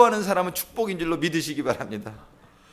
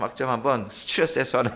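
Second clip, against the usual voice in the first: laughing aside; 4 semitones lower, 4 dB quieter than usual. Real, not acted. Joking about Friday prayer as stress relief.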